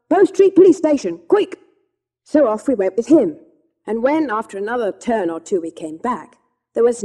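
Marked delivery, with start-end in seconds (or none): 0.1-1.6 a loud, high, urgent call. Acted. Red-faced gentleman orders the cabman, loud and urgent. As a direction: shout the address in a hurry. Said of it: "and called out".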